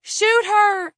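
very high, loud scream; frantic yell. A narrator's reading. Bystander urging the officer to fire, frantic yell. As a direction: yell it out in alarm. Onlooker yells during attack.

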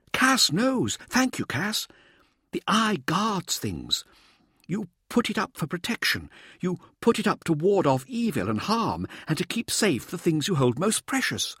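high, insistent showboater's voice; showboating Mirza. A narrator's reading. Showboater prompts his anxious audience, high and insistent. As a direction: lecture the team in a high, theatrical voice.

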